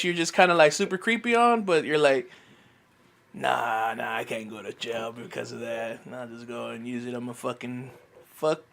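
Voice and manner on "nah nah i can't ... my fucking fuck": low, hushed thinking to oneself; private inner thought. Retold or imitated. Man talking himself out of jail, low and hushed. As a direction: drop way down in pitch and volume, as if reasoning inwardly.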